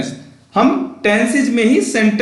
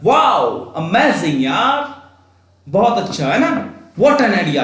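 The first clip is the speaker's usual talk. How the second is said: excited amazement; much slower than usual. Shown as a demonstration. Teacher demos strong feeling for students, amazed. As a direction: gush over a great idea. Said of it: "strong feeling".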